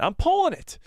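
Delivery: high excited squeal. Real, not acted. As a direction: yelp it with delight. Repeated higher, excited.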